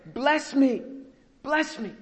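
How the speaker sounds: high, desperate begging; pleading cry. Retold or imitated. Esau begs his father again, high pleading cry. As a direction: beg it twice, breaking. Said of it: "So he cries out again".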